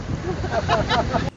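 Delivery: high breathy giggle between takes. Real, not acted. Mate cracking up between retakes of the line, giggle. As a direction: break into a giggle mid-take.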